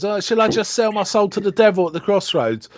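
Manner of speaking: joking. Retold or imitated. Retelling a character's joke about selling his soul, jokingly. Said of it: "he's making a joke".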